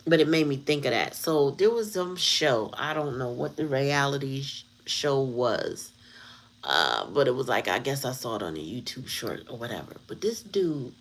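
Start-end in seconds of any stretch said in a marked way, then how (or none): none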